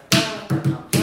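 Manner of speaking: loud, hammered "tin" on each beat; mock metronome beat. Shown as a demonstration. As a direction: pound out a metronome's mechanical beat. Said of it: "on ne devrait pas entendre". (battement de métronome martelé, très fort)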